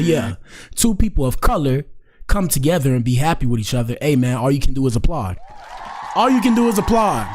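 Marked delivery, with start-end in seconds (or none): 6.1-7.3 much higher-pitched proclamation; emphatic exclamation. Real, not acted. Host repeats his line to hype the couple, emphatic.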